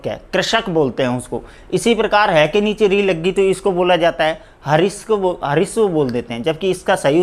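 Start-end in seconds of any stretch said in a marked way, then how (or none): none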